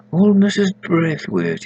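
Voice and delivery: lightly voiced country vicar; vicar musing. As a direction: recall her name slowly, surprised. Vicar thinks aloud.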